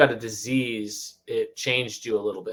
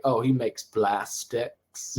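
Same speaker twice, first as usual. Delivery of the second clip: put-on drawn-out Southern boy; 4 dB quieter, much slower than usual. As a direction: hold on to the words like a Southern kid. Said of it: "like, held on to words".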